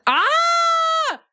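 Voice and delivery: sudden high squeal; high yelp. Pretend. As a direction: yelp high when jostled. Yelps while being shaken.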